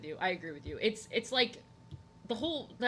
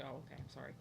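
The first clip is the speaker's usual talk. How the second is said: low, very soft little apology; meek apology; 6 semitones lower, 14 dB quieter, faster than usual. Retold or imitated. Speaker to the girl who kicked them out, meek apology. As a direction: retell it small and meek.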